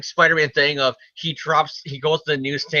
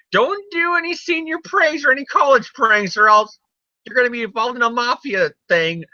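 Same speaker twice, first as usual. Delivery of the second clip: mock Stan Lee lecture; 11 semitones higher than usual. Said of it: "Stan Lee is basically saying that".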